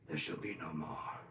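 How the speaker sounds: sinister doom voice. Pretend. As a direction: intone it like a dark prophecy. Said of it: "Why does that sound sinister?".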